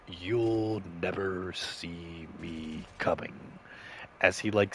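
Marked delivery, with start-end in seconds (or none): 0.1-3.3 menacing villain threat. Retold or imitated. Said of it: "he says".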